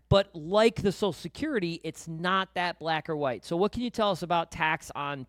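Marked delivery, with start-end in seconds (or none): none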